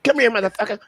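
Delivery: high threatening growl; menacing boxer threat; Mike Tyson voice. Retold or imitated. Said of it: "The motherfucker was like".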